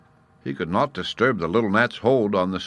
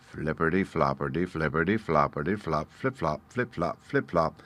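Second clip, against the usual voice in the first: softer, sing-song creeping steps; creeping rhythm; 3 semitones lower, 6 dB quieter than usual. A narrator's reading. Narrator sounding the spider creeping down, soft. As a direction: tiptoe the spider down.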